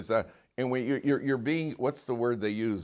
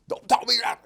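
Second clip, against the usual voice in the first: high and loud, indignant; angry relative shouting down the peacemaker; angry retort; 14 semitones higher, 9 dB louder than usual. Retold or imitated. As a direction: throw it back at them hotly. Angry person snaps back.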